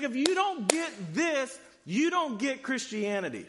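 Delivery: preacher's urgent warning; emphatic declaration. Retold or imitated. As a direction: make them feel the weight. Jesus stresses the point.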